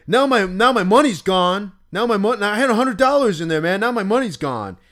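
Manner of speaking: high, loud, fast aggrieved complaint; upset accusation. Retold or imitated. Robbed guy accusing the prankster over missing money, high aggrieved rant. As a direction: whine about the stolen money, accusing. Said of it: "He's like".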